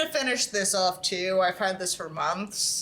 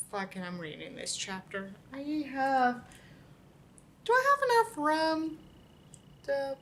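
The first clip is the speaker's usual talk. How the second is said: ordinary talk, nothing marked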